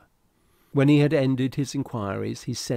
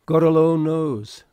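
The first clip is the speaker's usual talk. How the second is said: loud outburst, much higher and louder; bursting out; 6 semitones higher, 10 dB louder than usual. A narrator's reading. Second mate to the captain, bursting out loudly. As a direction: blurt it out in alarm. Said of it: "burst out the other".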